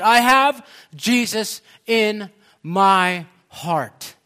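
loud, slow, drawn-out proclamation; crying out. Retold or imitated. Preacher quoting the healed man's confession of faith, crying out. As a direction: cry it out word by word with great fervour. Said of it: "saying and crying out".

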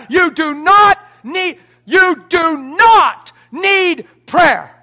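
not drawn out slow and loud; stress on not. Acted. Pastor insisting to the church, slow and loud. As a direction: lean hard on not, very slowly.

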